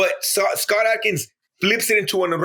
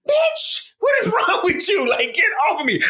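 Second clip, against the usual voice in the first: mock shout. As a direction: yell it off in a panic. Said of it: "and he's like".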